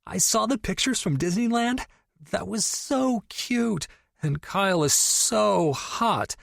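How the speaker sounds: overexcited friend teasing about the stepbrother; gushing best friend. Acted. Tori gushing over video call.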